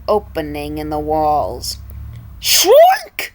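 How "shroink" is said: high, whispered pig squeal; despondent grunt. Acted. King Pig as the tower collapses, high whispered squeal. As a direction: squeal it out breathless as the tower falls. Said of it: "grunted despondently".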